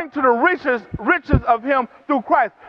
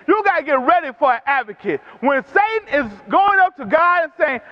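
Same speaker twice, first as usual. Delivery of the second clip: usual speaking voice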